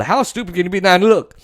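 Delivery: normal talking voice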